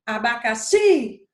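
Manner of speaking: "Abacaxi" slow, with a loud held "xi"; stress on last syllable. Shown as a demonstration. Teacher giving an oxytone example, "Abacaxi" punched on "xi". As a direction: hit the final "xi" of "Abacaxi" hard and long. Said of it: "classificar essa palavra como oxítona".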